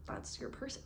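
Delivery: breathy whisper, nearly gone; hushed and choked up over the love story. Real, not acted. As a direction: drop to a moved whisper. Tearing up over romance.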